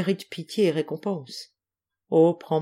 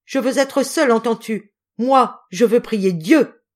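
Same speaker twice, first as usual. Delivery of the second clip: loud, high-pitched impassioned denunciation; desperate, raging indignation; furious outrage; 5 semitones higher, 8 dB louder than usual. A narrator's reading. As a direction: defend your child with full fury. Mother rages at husband threatening her child.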